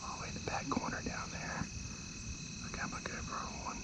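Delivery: hushed whisper so the deer won't hear; whispering excitedly from the blind; wildlife watching whisper. Real, not acted. Hunter spotting buck, whispering.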